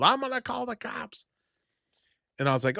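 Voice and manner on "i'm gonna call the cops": held-back high mock holler; mock yell. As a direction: imitate a neighbor yelling from down the street. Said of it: "she just yelled".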